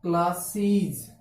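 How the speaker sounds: Classes said slowly, each sound drawn out for students; slow spelled-out demo. Shown as a demonstration. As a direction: stretch classes out syllable by syllable. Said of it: "क्या होता है?". (Classes को धीरे-धीरे खींचकर बोलो)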